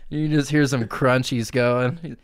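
ordinary delivery

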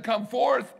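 loud, high summons; commanding call. Retold or imitated. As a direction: call it out with authority. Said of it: "If he would have just said".

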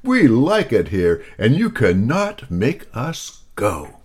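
brushing the pig off; lightly voiced alien leader. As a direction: alien leader refusing, mildly gruff.

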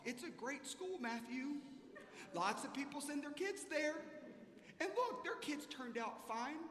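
a hint of a wife soothing him; wife's pep talk.

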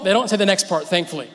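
usual speaking voice

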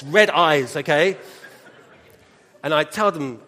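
normal talking voice

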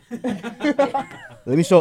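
laughing. Real, not acted. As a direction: stretch it out while laughing. Laughing while showing picture.

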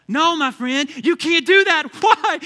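normal talking voice

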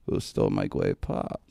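low, slow, lisping Elmer Fudd impression. [name] imitating the kicker demanding his pop, slow low Fudd voice. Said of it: "Like that".

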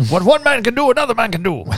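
high, loud, hammed-up movie quote; Anthony Hopkins impression. Quoting a movie line to his podcast friend, hammed-up and loud. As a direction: belt the line like a rousing movie hero. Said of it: "That was my best Anthony Hopkins".

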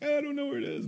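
high-pitched helpless squeal of denial; mock-panicked high voice; squirming suspect. Acts out interrogated suspect.

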